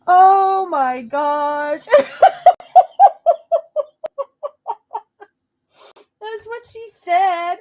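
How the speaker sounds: words squeezed out high through helpless laughter; cracking up, barely able to talk; laughing hard. Real, not acted. Laughing fit at clip.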